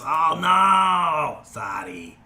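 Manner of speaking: high, loud cry dropping to a small apology; Pungent Sky's yell. Acted. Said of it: "And she yells".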